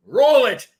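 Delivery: mock host cue, loud; loud Roll it. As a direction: bark the cue to roll tape. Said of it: "as soon as you hear him go".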